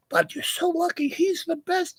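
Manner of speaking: high mock girly voice; gushing models. Models gushing to the wife about him, in a high mock squeal.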